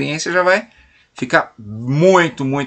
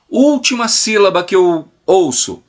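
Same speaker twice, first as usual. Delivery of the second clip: ordinary delivery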